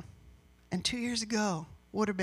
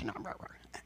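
whispered trailing off; 12 semitones lower, 12 dB quieter than usual. Real, not acted. Trailing off sister's complaints.

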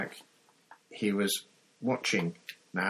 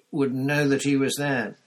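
usual speaking voice

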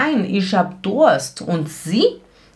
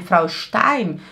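usual speaking voice

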